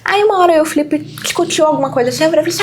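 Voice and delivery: ordinary talk, nothing marked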